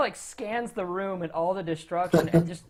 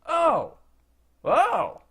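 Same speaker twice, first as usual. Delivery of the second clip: dawning realization; 8 semitones higher, 5 dB louder than usual. Acted. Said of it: "he's, like, looking around and he says".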